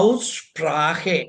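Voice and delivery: ഔസ്പ്രാഹെ said slowly, ows-shprah-he; German Aussprache pronounced carefully; German word Aussprache. Shown as a demonstration. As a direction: spell out ഔസ്പ്രാഹെ slowly, syllable by syllable. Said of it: "ജർമ്മനിൽ പറയുക".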